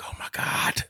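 breathy whisper in fear; whispered dread. Retold or imitated. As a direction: whisper it in horror. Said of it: "I'm like".

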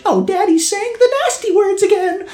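put-on a small boy tattling on his dad; child voice. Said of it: "he's just like".

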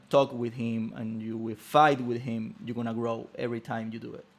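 usual speaking voice

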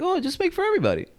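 high, quick imitation of mom; light mock mom voice.

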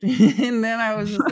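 laughing loudly through the words. Real, not acted. Laughing as he tells the interviewer about growing a beard. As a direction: break into a big laugh while telling it.

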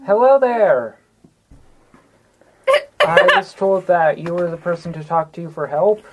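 normal talking voice

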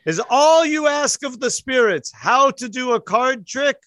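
high, loud, mocking ghostly cry; booming spirit voice. Spirit in the mist taunting the audience, loud and high. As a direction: scoff at them from beyond, loud and high.

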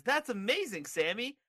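high-pitched, delighted amazement; Bray Wyatt. Acted. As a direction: gush at a surprise present. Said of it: "the shocked face".

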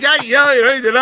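high, loud eager "yeah, yeah"; high excited yeah yeah; giddy agreement. Retold or imitated. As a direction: bounce it up high like a delighted friend. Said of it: "he's like".

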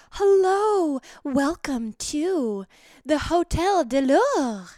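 sexy hotel maid. Pretend. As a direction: greet him at the door like a flirty maid, slowly. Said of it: "like a sexy little maid".

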